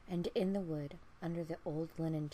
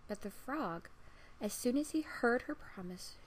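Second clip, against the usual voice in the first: ordinary delivery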